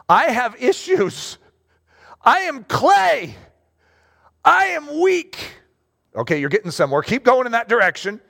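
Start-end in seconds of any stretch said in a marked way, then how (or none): 0.1-5.6 high, drawn-out admission, building; slow, earnest owning-up; emphatic confession. Acted. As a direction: stretch each admission out, climbing higher as you go. Acted confession of weakness to God.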